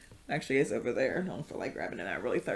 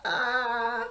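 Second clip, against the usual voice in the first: blissful sigh; 6 semitones higher than usual. Retold or imitated. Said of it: "I'll be like".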